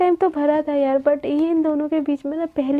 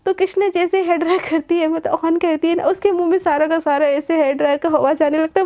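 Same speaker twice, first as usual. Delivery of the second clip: ordinary delivery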